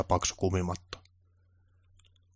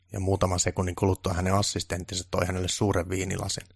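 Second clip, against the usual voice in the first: plain reading voice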